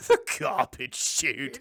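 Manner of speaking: slow, loud put-on cry; mock horrified father. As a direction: exclaim it slowly in a hammed-up character voice. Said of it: "and he goes".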